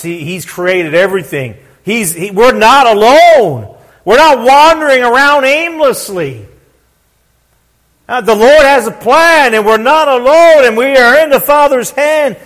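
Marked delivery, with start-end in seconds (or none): 1.8-12.4 raised, punchy sermon delivery; impassioned preaching. Real, not acted. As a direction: preach it out loud with conviction. Preaches with force.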